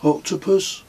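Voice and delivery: put-on high, questioning boy's guess. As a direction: toss out a hopeful kid's guess. Kez guesses riddle.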